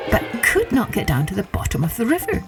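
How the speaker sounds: ordinary delivery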